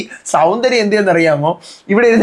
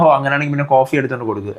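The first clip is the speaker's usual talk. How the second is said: joking aside; 7 semitones lower, 4 dB quieter, faster than usual. Retold or imitated. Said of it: "just ഒരു comedy പറയുകയാണ്".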